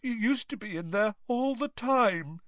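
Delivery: put-on high, whiny girl ghost; Myrtle's ghost voice. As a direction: pitch up into a mopey schoolgirl ghost.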